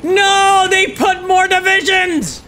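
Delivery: high, loud, slowed yell; dismayed shout. Real, not acted. Gamer shouting as enemy adds divisions. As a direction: cry out at the bad news.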